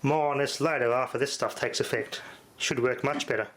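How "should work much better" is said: usual speaking voice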